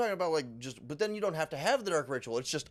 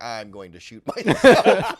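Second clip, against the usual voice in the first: laughing despair; 15 dB louder, slower than usual. Retold or imitated. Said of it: "and I'm like".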